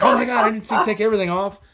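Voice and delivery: mock flustered shock. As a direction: blurt it out as the startled nurse. Nurse shocked at naked patient.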